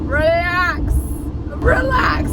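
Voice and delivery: yelled relax rising high; loud yell. Real, not acted. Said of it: "I'm losing my voice".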